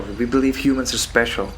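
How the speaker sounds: ordinary delivery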